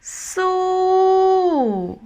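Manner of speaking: long "So" held high before falling. Shown as a demonstration. Teacher showing what the voice does, long falling "So". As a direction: stretch "So" on a high note, then drop. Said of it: "my voice is kind of like".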